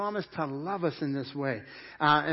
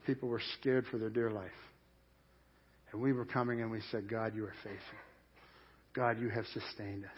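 hushed recollection; 6 semitones lower, 9 dB quieter than usual. Retold or imitated. Remembering church after nine eleven.

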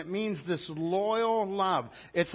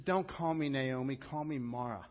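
normal talking voice

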